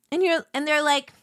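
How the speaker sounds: usual speaking voice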